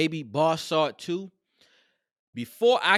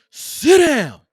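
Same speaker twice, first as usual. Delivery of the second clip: loud, sharp command, pitched up; mock manager barking sit down; barked order; 9 semitones higher, 13 dB louder than usual. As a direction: bark it like an angry boss.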